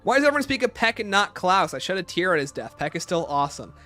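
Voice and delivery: ordinary talk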